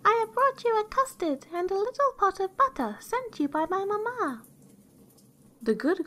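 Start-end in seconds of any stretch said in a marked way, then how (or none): 0.1-4.4 very high, put-on little-girl voice; wolf faking a child, squeaky high; counterfeit girl's voice. A narrator's reading. Wolf at granny's door, counterfeiting the girl's high voice. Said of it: "counterfeiting her voice".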